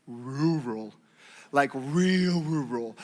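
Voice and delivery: low, slow stress on "real rural"; drawn-out emphasis. Real, not acted. Telling how country the school was, low and drawn out. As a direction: drop low and stretch "rural".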